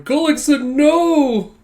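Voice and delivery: high, loud mock wail of dismay; dismayed cry. Goons see their comrade sent flying, dismayed shout.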